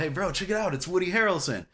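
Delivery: acting out a star sighting; excited alert. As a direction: get your buddy's attention, excitedly. Retelling alerting his friend.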